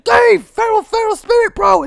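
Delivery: very high, loud cartoon squeal; squeaky feral spirit. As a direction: shout like a dopey little creature. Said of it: "that's how they speak".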